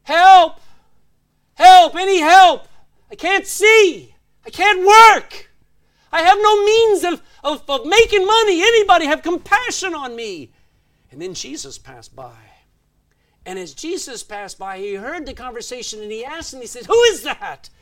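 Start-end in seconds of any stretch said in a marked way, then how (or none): 0.0-10.5 desperate high, full-force shout; crying out. Retold or imitated. Blind beggar calling to people passing by, crying out. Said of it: "he would cry out". 16.8-17.7 loud, high-pitched question; calling out. Retold or imitated. Blind beggar hears Jesus pass and asks who, calling out.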